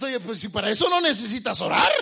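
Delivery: loud, high mock scolding; hammed-up parental outburst; exasperated parent. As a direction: shout it at the lazy child in disbelief. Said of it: "¿Verdad que le dices".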